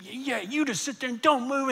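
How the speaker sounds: high mock scolding at normal volume; retold angry scolding. Pastor acting out his tirade at a crying boy, high mock scolding. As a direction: imitate an adult still ranting at a child. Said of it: "when I finished screaming at him".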